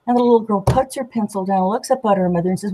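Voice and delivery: normal talking voice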